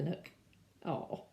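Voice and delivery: usual speaking voice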